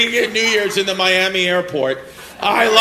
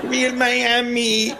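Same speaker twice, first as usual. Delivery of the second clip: drawn-out, higher mimicry of a local's hype; mimicking an audience member; 4 semitones higher, much slower than usual. Comedian echoes audience member's Miami line, slow drawn-out mimicry. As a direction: stretch it out slowly, higher, as a mock echo.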